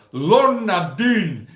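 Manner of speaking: high, loud, slow put-on Scottish; loud and slow playful Scottish accent; mock Scottish voice. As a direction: stretch the name out in a hammed-up Scottish accent. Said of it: "I automatically go".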